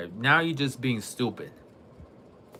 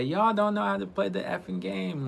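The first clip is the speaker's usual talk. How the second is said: higher, lightly voiced rude gamer; a hint of mocking the guy; mimicking rude player; 9 semitones higher than usual. Streamer mimicking a rude teammate he dislikes, lightly mocking. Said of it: "The way he talk and say".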